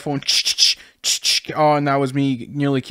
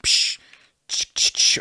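unvoiced "psh" sword effects; mouthed stabbing sounds. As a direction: imitate sword stabs with your mouth. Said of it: "that's me stabbing him".

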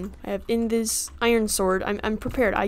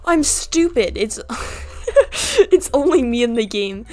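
laughing at oneself; 2 semitones higher, 6 dB louder than usual. Real, not acted. Player realizing they are alone, laughing self-mockery. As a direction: crack up mid-sentence, voice pitched up. Laughing at own silly mistake.

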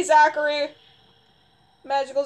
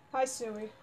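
normal talking voice

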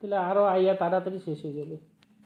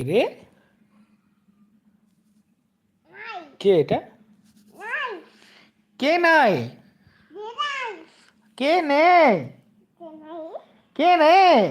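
put-on higher and louder, playfully calling out for the child; mock searching call; 10 semitones higher, 8 dB louder, slower than usual. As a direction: pretend to hunt for the hidden baby. Mock seeking again.

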